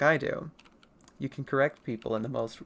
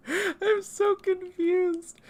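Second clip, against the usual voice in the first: very high, slow, stretched-out whine; mock confusion; much slower than usual. Streamer mock confused to chat, high drawn-out whine. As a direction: squeak it out in fake bewilderment.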